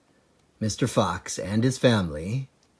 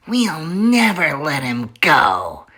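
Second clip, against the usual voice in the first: higher character voice declaring; declaring Bunce; 5 semitones higher, 3 dB louder than usual. Acted. Bunce vowing to his partners, declaring. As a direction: proclaim it like a stubborn farmer. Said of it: "Bunce declared".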